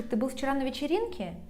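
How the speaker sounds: rapid question peaking on "вечеринке"; stress on ВЕЧЕРИНКЕ. Shown as a demonstration. Teacher checking the place was a party, stressing "вечеринке". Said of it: "I could ask you like this".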